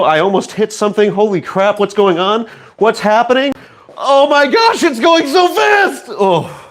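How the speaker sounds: high, shouting while spinning dizzy; spinning in office chair. Real, not acted. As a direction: yelp it out while whirling round fast. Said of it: "I'm dizzy".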